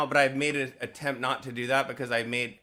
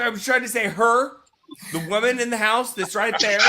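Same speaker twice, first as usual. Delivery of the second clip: high, loud acted exasperation; exasperated outburst; 6 semitones higher, 6 dB louder than usual. Process server insisting the kid said it was her, exasperated. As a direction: argue back as if fed up and accused.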